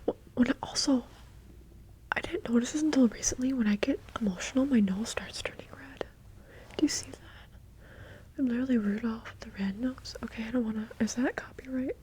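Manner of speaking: close, unvoiced whispered chat; ASMR whisper. Real, not acted. ASMR creator chatting to viewers in a whisper. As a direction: whisper intimately into the mic.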